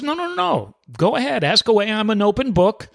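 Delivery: a hint of an eager lady saying go ahead; Nancy voiced lightly. Nancy eagerly agrees to questions.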